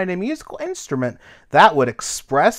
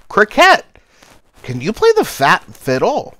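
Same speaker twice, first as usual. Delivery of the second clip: calling out; 6 semitones higher, 5 dB louder than usual. Acted. A bug calling to Cricket.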